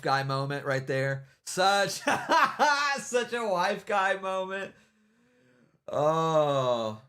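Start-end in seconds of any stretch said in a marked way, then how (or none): 1.5-7.0 high, laughing through the words; real laughter breaking up the phrase. Real, not acted. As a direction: crack up while repeating the jibe. Cracking up at his own mockery.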